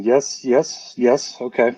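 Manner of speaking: ordinary delivery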